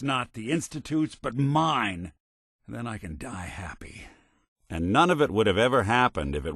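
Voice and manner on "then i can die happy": "then I can die happy" dropped to a hushed, softer voice; quiet and moved. Acted. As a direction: let it fall away softly, at peace. Quiet emotional confession.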